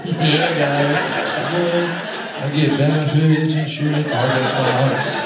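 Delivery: loud, badly faked Jamaican accent. Limping tough guy boasting of his perks, bad Jamaican accent. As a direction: put on a bad Jamaican swagger. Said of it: "That was my Jamaican accent".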